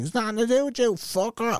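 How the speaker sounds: high-pitched mimicked retort; angry woman imitation. Woman telling a bystander to stay out, high mock screech. Woman snaps back at the intervening speaker.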